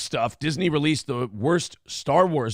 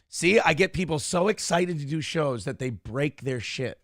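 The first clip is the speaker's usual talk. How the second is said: normal talking voice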